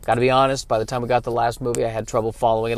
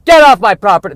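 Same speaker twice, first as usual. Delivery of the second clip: put-on loud high crotchety old man shouting; cranky old man yell; 9 semitones higher, 15 dB louder than usual. Bird as old man yelling at neighbors to get off. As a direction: bark it like a grumpy old man off his lawn. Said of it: "birds are old men".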